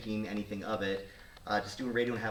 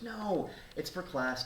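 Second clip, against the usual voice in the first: dismissive reassurance; 9 semitones higher, much slower than usual. Retold or imitated. Photographer brushes off friend's worry.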